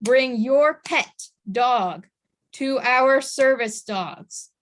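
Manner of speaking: slow, each word set apart; repeating a rule to the audience, slow and firm; firm emphasis. Shown as a demonstration. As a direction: drive the warning home slowly. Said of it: "I will say this again".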